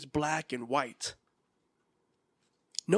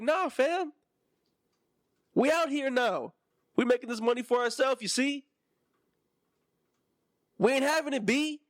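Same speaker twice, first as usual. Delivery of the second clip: put-on New York accent; 8 semitones higher than usual. Settlers telling the king no, in a hammed-up New York accent. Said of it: "I'm sorry they got New York accents".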